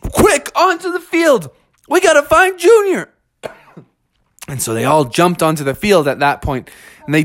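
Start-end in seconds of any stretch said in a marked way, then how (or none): 0.0-3.1 high-pitched rallying cry; panicked father calling out, high; urgent shout. Acted. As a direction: call the family to hurry. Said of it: "he shouted to the rest".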